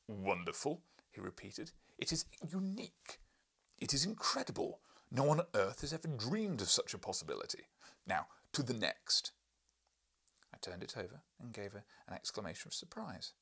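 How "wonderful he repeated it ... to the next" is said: excited outburst. Acted. Professor exulting over the sketch to the narrator. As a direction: trumpet each claim louder.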